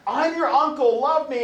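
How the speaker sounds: high, loud gushing relative voice; pushy relative. Retold or imitated. Uncle looming over a frightened baby, high and loud. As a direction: coo at the baby like an overeager uncle.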